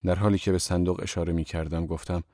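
usual speaking voice

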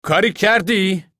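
high, loud and slow call. A narrator's reading. Floyd calling out to the man from the sedan. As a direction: call across to the neighbour. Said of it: "فلوید صدا زد".

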